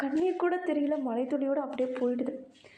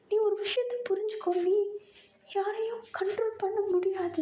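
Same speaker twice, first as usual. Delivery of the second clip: much higher, crying and begging; higher, voice breaking as he pleads to leave; tearful plea; 9 semitones higher than usual. Acted. Tae voiced high, crying. (உயர்ந்த குரலில் அழுதபடி கெஞ்சல்)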